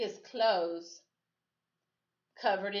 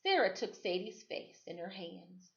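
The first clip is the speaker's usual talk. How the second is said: plain reading voice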